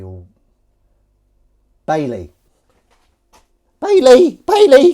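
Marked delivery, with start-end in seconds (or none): 3.8-4.9 very high, loud pet call; calling a cat. Pretend. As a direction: holler the name in a falsetto. Calls the cat by name.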